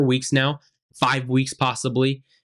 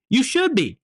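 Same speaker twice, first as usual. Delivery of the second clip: rising outrage; 10 semitones higher than usual. Real, not acted. Ranting about fans' fury.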